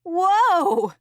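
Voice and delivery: high loud yelp of surprise; startled whoa. A narrator's reading. Puppy losing balance while walking. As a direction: yelp as you slip.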